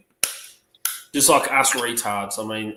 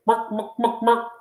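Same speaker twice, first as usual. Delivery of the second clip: high, staccato hen clucks; little girl's chicken impression; chicken clucking; 10 semitones higher, 4 dB louder, slower than usual. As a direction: imitate a chicken in short high bursts. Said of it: "What sound does a chicken make".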